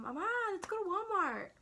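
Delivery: high, swooping call to mom; hammed-up high-pitched coaxing; singsong request. Daughter asks mom to go to Walmart, singsong.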